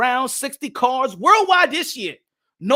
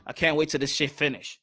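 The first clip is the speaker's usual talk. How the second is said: lower, softer, fed-up mumble; bored Eddie Hearn; 8 semitones lower, 8 dB quieter than usual. Retold or imitated. Eddie Hearn at the New Hampshire fight, lower bored mock grumble. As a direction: sound like you'd rather be anywhere else. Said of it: "He'd be like".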